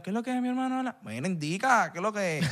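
teasing imitation of a Puerto Rican accent; mock Puerto Rican accent. Friend back from abroad, greeting in a Puerto Rican accent. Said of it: "Y tú lo ves".

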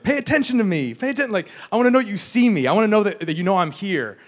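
high, pleading attention-seeker; mock needy demand. Newlywed running over to a busy spouse, mock needy demand. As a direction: playfully whine to be noticed.

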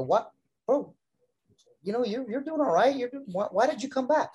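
lightly imitated puzzlement, pitched high; puzzled questioning. Asking a returning wrestler why he came back, mildly puzzled. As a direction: ask a friend in high, mild disbelief.

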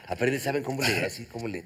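low, soft, put-on dopey voice; strange low voice. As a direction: drop into a silly low murmur. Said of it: "¿Y tú por qué hablas como pendejo así?".

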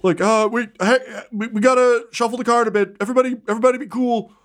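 high, hurried ringside patter; flustered arena announcer. Acted. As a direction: keep the crowd calm while panicking. Announcer scrambling on the mic.

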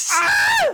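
very high-pitched mock scream; screaming mouse impersonation. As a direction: shriek like a noisy computer mouse when clicked. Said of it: "This is my impersonation of your mouse".

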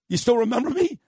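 high-pitched mock disbelief; retold surprised question. Host to cop who recognized him, retold high surprised question. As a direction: ask it as if amazed, voice lifting high.

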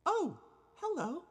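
very high, slow put-on little girl voice; hammed-up high child voice. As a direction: grown man squeaking as a little girl. Rickety dressed as ghost girl.

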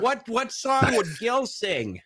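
very high put-on character voice; Gil. Asking what Gil would sing, in a high mimicking voice. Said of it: "talks like this".

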